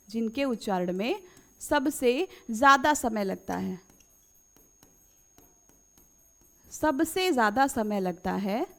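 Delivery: measured dictation pace. Shown as a demonstration. Dictating definition slowly to students.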